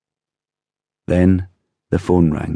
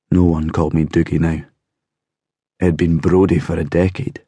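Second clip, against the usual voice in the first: plain reading voice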